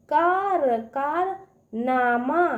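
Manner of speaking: कारनामा drawn out slowly, part by part; sounding out syllables. Shown as a demonstration. Teacher breaks a long word for kids, slowly. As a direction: spell कारनामा out in slow pieces. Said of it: "उसको तोड़ तोड़ पढ़िए".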